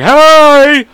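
loud, high, drawn-out hey; hollered greeting. Retold or imitated. Imitating the greeting as she comes outside, high holler. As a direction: call out in a hammed-up squeal. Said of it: "come out and be like".

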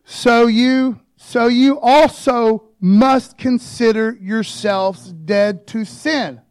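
slow, loud, weighty verse reading; preaching the verse slowly and loudly; emphatic scripture reading. Acted. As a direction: lean on every word of the verse.

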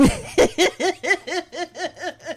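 high, rapid staccato laughter; real giggle fit. Real, not acted. Host remembering the daddy outside the courtroom, cracking up. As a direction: crack up helplessly at a memory.

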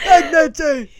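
loud, high mock New York "Egg, and cheese"; New York accent. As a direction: squawk it like a New Yorker. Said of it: "a way that New Yorkers say it".

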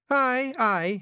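Billy's voice. Acted. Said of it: "he said".

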